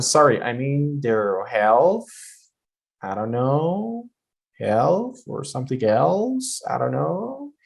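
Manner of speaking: unsure, drawn-out rises on "health" and "know"; rising intonation. Shown as a demonstration. Teacher imitates an uncertain exam answer, voice going up. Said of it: "voice goes up in this situation".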